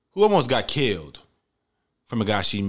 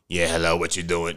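much lower mock deep voice; deep-voiced woman; 7 semitones lower than usual. Date answers his call in a mock deep voice. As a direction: imitate her surprisingly deep phone voice. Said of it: "But her voice was deep as fuck".

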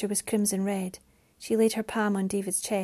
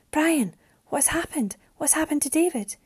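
higher-pitched, anxious pleading questions; alarmed questioning; 7 semitones higher than usual. A narrator's reading. Mariana asks Brian about his son, alarmed. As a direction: beg for an answer, voice raised in pitch. Shocked woman questions the father.

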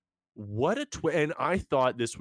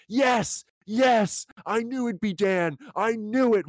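high, louder mock cheer in a put-on voice, reading his own notes; triumphant I-called-it cheer, higher and louder; excited note read out; 9 semitones higher, 4 dB louder, slower than usual. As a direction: read it like the exclamation points, pitched way up. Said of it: "with so many exclamation points".

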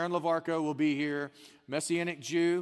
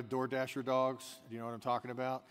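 ordinary talk, nothing marked

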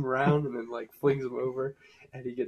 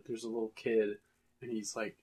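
ordinary delivery

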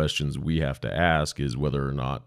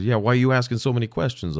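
higher, louder, fast grumpy complaint; impatient grumpy man voice; irritated man; 8 semitones higher, 5 dB louder, faster than usual. Retold or imitated. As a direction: fire it off like an irritated husband. Grumpy husband on phone.

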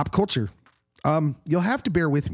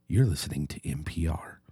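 soft, whispered radio-host read; mock smooth radio announcer; NPR announcer; 7 semitones lower, 4 dB quieter than usual. Shown as a demonstration. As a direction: breathe it like a late-night public radio voice. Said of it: "gonna sound a lot like N P R".

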